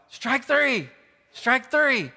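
loud, high call of strike three; yelled call. Retold or imitated. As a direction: shout it across the field like an umpire. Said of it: "I yelled out".

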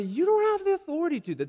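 high, fast mock protest; squeaked-up, rapid rebuke; indignant objection. Imagined people turn round and object.

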